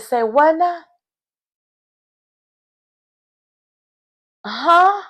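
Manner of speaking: high-pitched, baffled double take; played-up confused surprise; stunned disbelief. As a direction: squeak it out in disbelief. Reacting to a shocking reveal.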